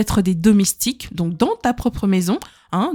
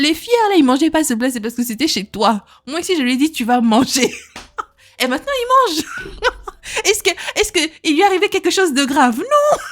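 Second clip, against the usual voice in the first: high, laughing teasing; wife telling mother-in-law, laughing and high; laughing retort; 6 semitones higher than usual. Retold or imitated. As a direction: tease while laughing. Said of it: "J'ai dit".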